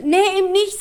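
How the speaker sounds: high, loud and worked up; high-pitched mock shout; shouting grandma. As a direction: complain loudly down the phone as granny. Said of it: "schreit sie".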